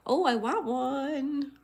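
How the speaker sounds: gasp, then a high, slow, stretched "Oh, I want one"; delighted gasp. Real, not acted. Streamer hears a viewer's stickers came, delighted high gasp. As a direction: swoon over the stickers.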